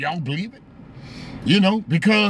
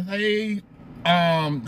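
ordinary delivery

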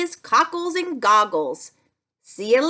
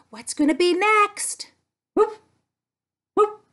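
normal talking voice

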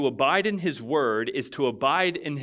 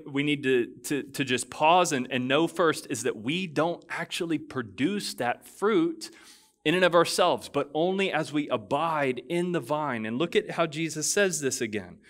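usual speaking voice

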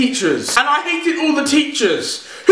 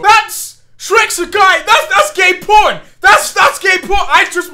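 shocked shouting; 5 semitones higher, 6 dB louder than usual. Real, not acted. Shocked reaction to clip.

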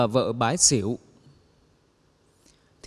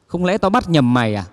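louder, gruff yell at the stray man; annoyed King of Hell; 6 dB louder than usual. Acted. King of Hell yells at the man not in line, angry shout. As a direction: bark at him like an irritated underworld judge. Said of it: "ông bực quá".

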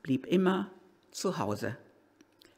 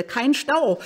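"kein Stau" higher and louder, pleading; urgent plea; 9 semitones higher, 6 dB louder than usual. Real, not acted. Late driver begging the traffic, louder. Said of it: "Kein Stau jetzt bitte".

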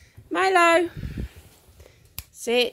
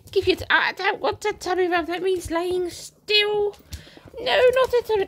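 high, quick mock protest voicing the dog; a put-on puppy voice; playful dog voice; 8 semitones higher, 3 dB louder than usual. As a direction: answer for the dog in a squeaky voice. Voicing the dog's reply.

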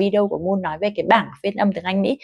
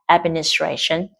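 "Administration" with main stress on tra, secondary on ad; 3 semitones lower than usual. Shown as a demonstration. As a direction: hit tra, lighter on ad. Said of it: "trong âm phụ ở ad". (nhấn chính "tra", phụ "ad")

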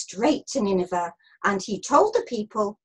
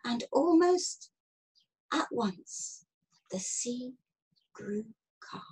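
fading to a near whisper; slow down and hush, word by word; hushed calm; 7 dB quieter, slower than usual. A narrator's reading. Narrator telling how the sea calmed, hushed. Narrator voices the storm calming.

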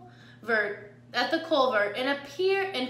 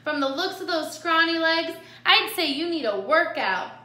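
louder, higher sassy retort; sassy armadillo; 6 semitones higher, 5 dB louder than usual. A narrator's reading. Lily sizes up the scrawny coyote, sassy and loud. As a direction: taunt the coyote in a raised voice.

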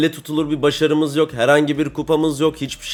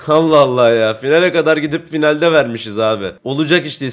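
ordinary talk, nothing marked

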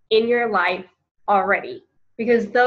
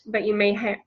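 usual speaking voice

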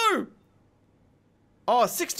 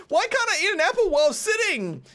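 exasperated disbelief; 11 semitones higher, 3 dB louder than usual. Real, not acted. Indignant at game rules.

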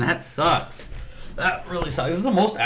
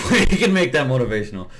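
quick, loud laughing words; 4 semitones higher, 6 dB louder, faster than usual. Real, not acted. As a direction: laugh through the sentence. Laughs at his own line.

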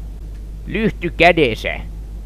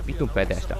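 ordinary talk

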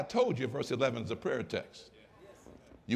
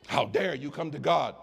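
ordinary delivery

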